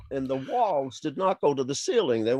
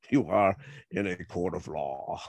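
lower, softer stern warning; stern judge; 8 semitones lower, 4 dB quieter than usual. Retold or imitated. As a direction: put him in his place. Judge warns the cursing friend.

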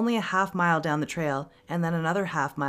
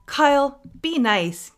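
sharp, loud, higher-pitched reprimand; hissed scolding; 10 semitones higher, 8 dB louder, slower than usual. A narrator's reading. Girl scolding a friend for teasing his brother, sharp hiss. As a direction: tell him off sharply. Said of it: "Kimber hissed".

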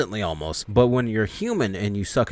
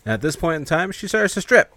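ordinary talk, nothing marked

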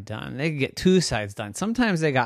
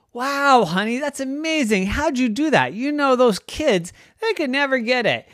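high-pitched amazement; impressed dad; 9 semitones higher than usual. Acted. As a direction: marvel at a spouse's feat. Daddy admires Mommy's feat.

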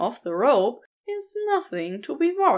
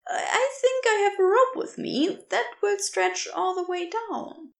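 higher, lighter voice for the kind stranger; gentle rescuer voice; 9 semitones higher than usual. Acted. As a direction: offer your name brightly, a little quicker. Narrator voices Aziraphale.

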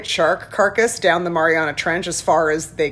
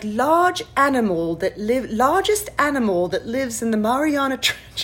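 much higher British girl's voice, imitated; imitating British child; 6 semitones higher than usual. Imitating the six-year-old's eager British question. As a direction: ask it like a curious little British girl. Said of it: "she's got this British accent".

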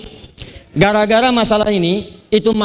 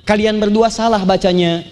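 ordinary talk, nothing marked

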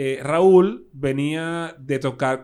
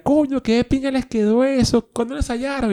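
high-voiced imitation of impressed fans; público asombrado; 9 semitones higher, 5 dB louder than usual. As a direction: gush as the amazed crowd, pitched up. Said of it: "Y la gente decía". (imitando al público, voz aguda)

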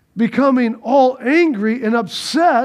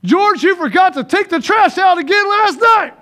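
high, loud scolding wife voice; nagging wife; 7 semitones higher, 4 dB louder than usual. Acted. Preacher voicing a wife scolding her husband, high and loud. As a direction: play the wife nagging about the trash.

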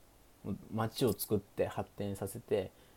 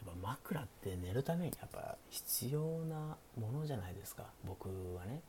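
hushed late-night chat, often whispered; sleepy; 4 dB quieter than usual. Pretend. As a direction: talk softly like the listener is drifting off. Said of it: "寝落ち配信なんでね". (小声でささやく寝落ち配信トーク)

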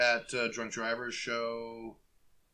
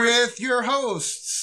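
announcer voice; 10 semitones higher, 10 dB louder, much slower than usual. Pretend. Mock podcast intro announcement.

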